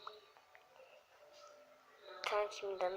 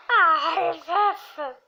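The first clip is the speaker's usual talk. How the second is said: loud, high cry of protest; shouting at Rafa; 6 semitones higher, 12 dB louder, much slower than usual. Real, not acted. Shouting at friend Rafa over the painted house, loud cry. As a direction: yell the name in dismay.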